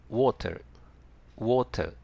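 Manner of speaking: British English "water" with a firm, clearly released T. Shown as a demonstration. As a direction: say "water" British-style with a crisp T. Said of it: "Tの発音をしっかりと発音しますから". (イギリス英語、Tをしっかり発音)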